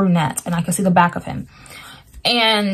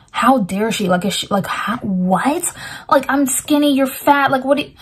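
mildly indignant, a touch higher on the end; indignant inner voice; 5 semitones higher than usual. Retold or imitated. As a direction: retell his offended thoughts, lightly voiced. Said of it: "that's how he was feeling".

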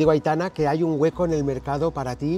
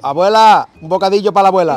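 calling out; 6 semitones higher, 12 dB louder than usual. Retold or imitated. Calls out jokingly.

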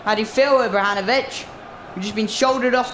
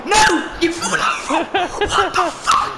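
furious yelling at the screen, higher and rising; angry shouting; 7 semitones higher, slower than usual. Real, not acted. Gamer yelling at his striker for falling over, furious. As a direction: rage at the footballer who fell over.

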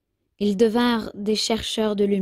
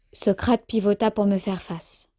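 ordinary delivery

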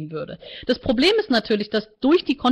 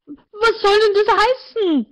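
much higher, slow, incredulous; shocked; 11 semitones higher, 4 dB louder, slower than usual. A narrator's reading. Martina asks the counselor, high and shocked. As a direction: stretch it out in shock.

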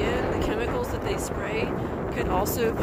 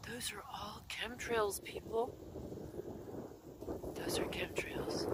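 much softer, partly whispered; hushed voice; 12 dB quieter than usual. Real, not acted. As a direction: murmur it close to the mic, conspiratorial. Hushed talk over sky footage.

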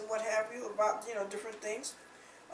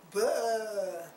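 a short mock retching noise; mock retching bah; 3 dB louder than usual. Preacher mimics a sick sinner retching, mock gagging. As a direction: gag it out like throwing up. Miming vomiting in sick bed.

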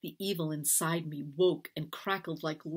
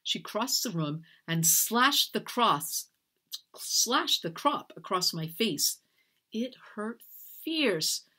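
usual speaking voice

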